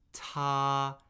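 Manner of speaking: "Ta" held high and flat; first tone. Shown as a demonstration. Teacher modelling tones for repeating, "Ta" high and flat. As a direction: say "Ta" on a high, level, unchanging pitch. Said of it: "first tone, high, flat, and unchanging".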